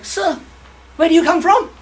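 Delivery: high, eager driver voice lifting at the end; chatty taxi driver. Retold or imitated. Taxi driver greeting his passenger, high and eager. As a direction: play the keen cabbie sizing up his fare.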